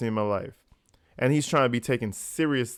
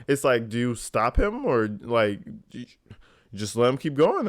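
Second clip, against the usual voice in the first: ordinary delivery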